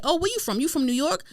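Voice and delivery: lightly voiced curious guy; quoting a stranger.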